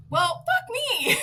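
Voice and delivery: high, slow, loud exasperated curse; retold yell. Zookeeper yelling at the husband on overlook, high loud curse. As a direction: holler it in comic disbelief. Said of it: "So I yelled".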